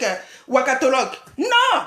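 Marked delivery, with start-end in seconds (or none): 1.4-1.8 sharp high-pitched exclamation of refusal; emphatic denial. Real, not acted. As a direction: cut the rumour off with a high outburst. Rejette vivement la rumeur rapportée. (démenti vif et indigné)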